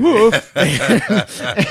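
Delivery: big burst of laughter; loud laugh. Real, not acted. Laughing at the story.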